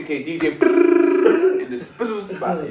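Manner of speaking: slow loud hype drop; hype announcement. Real, not acted.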